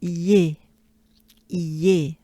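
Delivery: "Iye" as a short ee gliding into yay; shortened iye. Shown as a demonstration. Teacher drilling the end of Galibier, shortened iye. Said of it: "And it's shortened".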